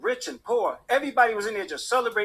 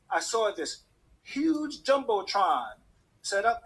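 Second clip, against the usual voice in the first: ordinary delivery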